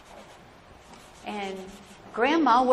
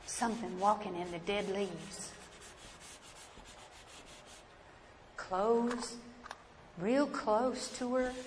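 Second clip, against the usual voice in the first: hushed; 4 semitones lower, 11 dB quieter than usual. A narrator's reading. Hushed suspense in dark woods.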